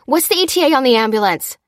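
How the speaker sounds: fast, loud, high question; rapid-fire, pressing demand; urgent demand. A narrator's reading. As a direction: demand an answer mid-effort. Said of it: "demanded Lucy between compressions".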